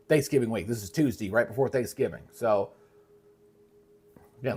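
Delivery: normal talking voice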